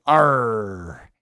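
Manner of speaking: a gruff pirate "Arr"; pirate growl. Pretend. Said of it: "practicing being a pirate".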